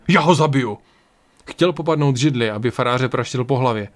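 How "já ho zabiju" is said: loud, forceful threat; horrified outcry. A narrator's reading. Ryan lunges to attack the priest, crying out. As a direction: burst out with the threat. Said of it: "vykřikl zděšený Ryan".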